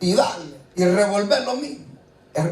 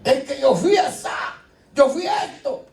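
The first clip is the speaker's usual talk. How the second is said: anguished confession; 8 semitones higher than usual. Retold or imitated. Repentant sinner confessing before the Lord, anguished cry. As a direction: pour out the guilt, voice climbing.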